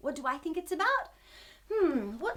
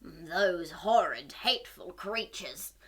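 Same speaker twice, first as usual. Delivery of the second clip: slow, lower sea-monster voice swelling loud on the last line; grumpy monster; 6 semitones lower, 9 dB louder, slower than usual. Acted. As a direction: sneer it out as a greedy monster. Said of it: "he cried".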